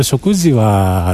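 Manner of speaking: drawn-out, deliberate question; repeating the question slowly to a puzzled staff member; slow careful repeat. Retold or imitated. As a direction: spell it out slowly for a confused clerk. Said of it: "もう一回言い直したら".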